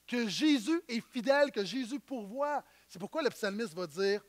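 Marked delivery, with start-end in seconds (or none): none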